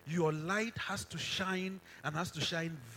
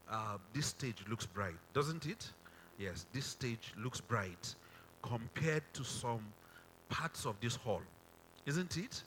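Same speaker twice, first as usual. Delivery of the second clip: normal talking voice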